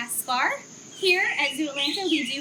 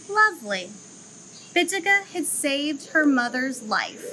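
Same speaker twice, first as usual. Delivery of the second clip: story narration; 3 semitones lower, 4 dB louder than usual. A narrator's reading. Zoo educator reading a lemur story to children, gently storytelling. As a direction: read it like a picture book for kids.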